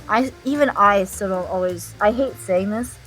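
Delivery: ordinary talk, nothing marked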